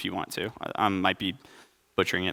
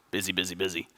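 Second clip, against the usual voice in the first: softer, worn-out muttered repeat; weary busy; 3 semitones lower, 8 dB quieter, faster than usual. Retold or imitated. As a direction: sound drained by the week. Weary reply after a sigh.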